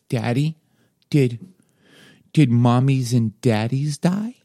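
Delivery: put-on high, slow little-kid question; a small child, scared and slow; toddler voice. Dad voices toddler son.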